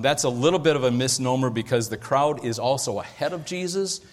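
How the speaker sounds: ordinary talk, nothing marked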